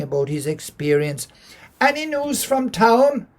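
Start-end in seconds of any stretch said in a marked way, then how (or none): 1.8-3.3 high, slow, sing-song wife's voice; wife's question. Acted. Wife asking her husband for news, high and slow. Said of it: "says Anstey".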